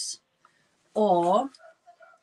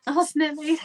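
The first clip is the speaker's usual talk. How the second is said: laughing; 7 semitones higher, 3 dB louder than usual. Real, not acted. Joking reply to chat.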